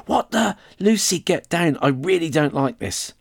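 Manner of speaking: high startled alarm; frightened. Acted. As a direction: get spooked and plead. Boy frightened by answering knocks.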